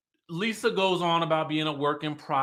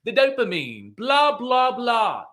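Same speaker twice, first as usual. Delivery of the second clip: light imitation of Dorit's accent, the blahs pitched up; 6 semitones higher than usual. Recapping Dorit explaining dopamine to Lisa, lightly mock Dorit's accent. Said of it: "Dorit in that accent".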